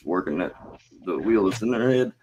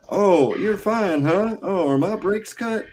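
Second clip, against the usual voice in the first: high, slow sarcastic questioning; mock suspicion; 10 semitones higher, slower than usual. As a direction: draw it out in exaggerated disbelief. Mocks someone who claims she's fine.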